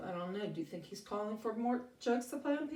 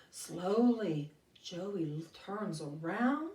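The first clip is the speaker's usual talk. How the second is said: ordinary talk, nothing marked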